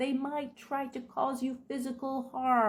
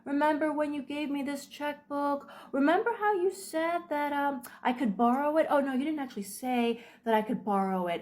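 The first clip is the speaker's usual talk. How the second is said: higher, sugary fake hurt; mock innocent manipulator; 6 semitones higher than usual. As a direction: play the wounded innocent to guilt-trip them. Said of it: "It'd be like".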